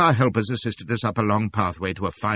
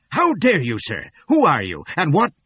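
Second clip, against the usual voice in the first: loud outraged demand; shouting; 7 semitones higher, 6 dB louder than usual. A narrator's reading. Stout old man confronting intruders, loud outraged shout. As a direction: bark it, outraged.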